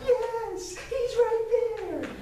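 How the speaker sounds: put-on high, slow, eager little kid; squeaky high kid voice, stretched out; eager child. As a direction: point him out like an excited grade-schooler. Kids pointing out the friend.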